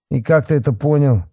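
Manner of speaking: high-pitched sceptical grumble; displeased. A narrator's reading. Policeman doubts the boy's find, displeased. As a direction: question him with sour disbelief. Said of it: "недовольно поморщился Бахметьев".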